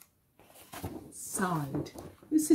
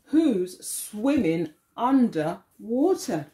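slow, drawn-out question; slow read-aloud. Acted. Teacher reading to children, slow and drawn out. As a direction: stretch the line out for the class.

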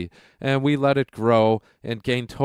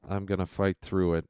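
ordinary talk, nothing marked

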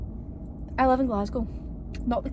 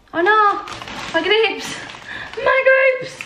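dismayed cry; 10 semitones higher, much slower than usual. Real, not acted. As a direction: cry out at the fallen grapes. Grapes fell out of box.

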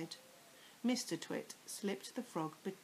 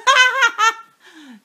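high, loud witch cackle; wicked cackle; 23 dB louder than usual. A narrator's reading. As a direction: cackle like a gleeful old witch. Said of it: "cackled Missus Twit".

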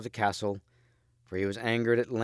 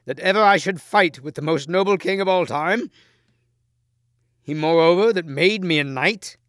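high, loud pleading knight; earnest Lancelot; 8 semitones higher, 8 dB louder than usual. A narrator's reading. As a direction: plead with his king, voice raised.

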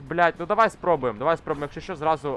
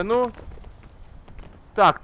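usual speaking voice

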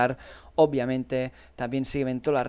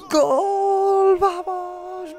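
very high, long drawn-out goal cry; goal scream; 13 semitones higher, 5 dB louder, much slower than usual. Real, not acted. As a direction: scream it out and hold the vowel. Celebrating the winning goal.

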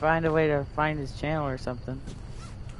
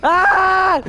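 panicked screaming; 10 semitones higher, 3 dB louder, much slower than usual. Real, not acted. Being chased by killer, screaming.

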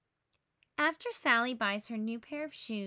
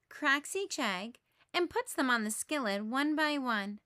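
ordinary delivery, nothing marked

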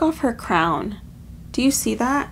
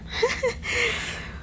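relieved laugh; 13 semitones higher, 7 dB quieter than usual. Real, not acted. Relieved laugh after tears.

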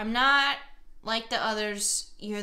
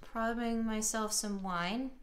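ordinary delivery

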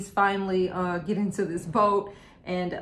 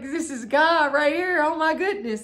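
much higher, louder mock awe; awestruck; 9 semitones higher, 6 dB louder than usual. Disciple realizing God stands beside him, amazed. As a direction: gasp it out in amazed wonder. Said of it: "he's like".

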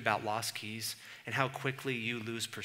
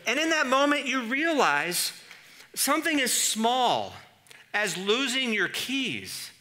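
heightened; 13 semitones higher, 10 dB louder than usual. Acted. Still heightened after the keys story.

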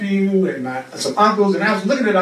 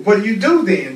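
ordinary talk

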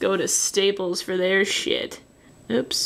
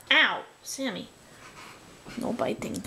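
usual speaking voice

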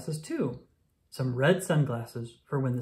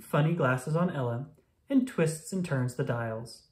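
usual speaking voice

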